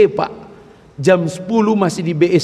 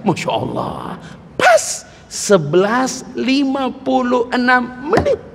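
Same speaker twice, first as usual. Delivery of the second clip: ordinary talk, nothing marked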